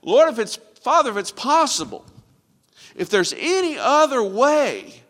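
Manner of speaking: high, loud, anguished plea that slows at the end; a retold cry from the cross, loud and high; pleading cry. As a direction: cry out to the Father in desperate pleading. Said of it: "And he cried out".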